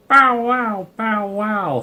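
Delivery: high, loud parrot squawk of "Bow wow". Acted. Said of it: "squawked Tilly".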